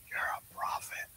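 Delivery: breathless, voiceless "You're a prophet"; awed whisper. Retold or imitated. Stunned bus driver whispers back to the preacher, awed. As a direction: whisper it in disbelief.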